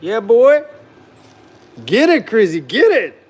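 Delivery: loud, high swooping cheer to a dog; excited egging-on. Real, not acted. Owner gives his dog meat, loudly egging him on. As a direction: hype the dog up with whooped commands.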